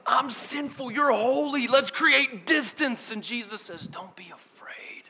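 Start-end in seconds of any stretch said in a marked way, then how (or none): 0.1-3.1 high, loud, insistent plea; raised-voice acted protest; panicked Peter. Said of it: "Look at what Peter's doing". 3.9-5.0 slow, hushed reassurance; soft, soothing whisper; gentle whisper. Retold or imitated. Jesus calming a fearful Peter.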